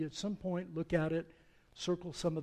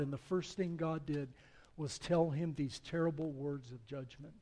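ordinary talk

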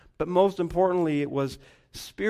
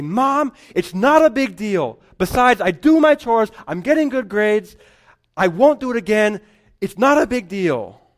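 mock teen brushing it off, higher and louder; protesting teen; 7 semitones higher, 9 dB louder than usual. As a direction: play the defiant kid pleading loudly. Rebellious child excuses to mom.

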